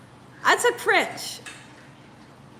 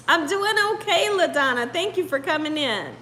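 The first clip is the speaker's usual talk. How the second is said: ordinary talk, nothing marked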